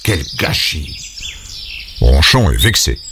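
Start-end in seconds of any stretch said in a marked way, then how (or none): 0.0-1.0 softer and lower grumble; grumpy low mutter. Acted. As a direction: grumble it under your breath. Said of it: "en marmonnant".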